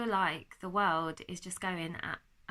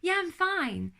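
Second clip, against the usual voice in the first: high, bright put-on fine; a higher, forced cheery reply to how are you; fake cheerful; 10 semitones higher, 4 dB louder, slower than usual. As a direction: mask it with a chirpy brush-off. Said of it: "And you say".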